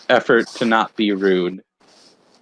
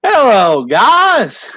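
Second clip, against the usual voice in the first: loud, high-pitched Southern drawl; hammed-up Southern holler; Southern accent; 10 semitones higher, 5 dB louder, much slower than usual. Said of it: "He's gonna be Southern".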